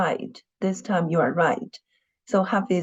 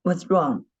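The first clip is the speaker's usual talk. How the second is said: usual speaking voice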